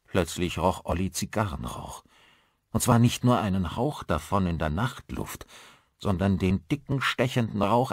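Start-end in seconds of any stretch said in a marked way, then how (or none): none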